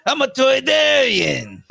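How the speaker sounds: high, slow alien junk dealer; Watto voice. Retold or imitated. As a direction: play the Toydarian trader. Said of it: "He goes".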